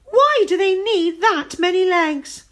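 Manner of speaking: high, loud scared cry; frightened. A narrator's reading. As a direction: keep shrieking in fright. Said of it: "she screamed".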